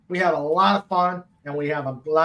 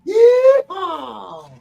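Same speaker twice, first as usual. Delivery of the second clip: whooping high rodeo cry; cowboy yeehaw; 14 semitones higher, 7 dB louder than usual. Pretend. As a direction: holler yeehaw like a cowboy riding the bomb. Major Kong cowboy cry.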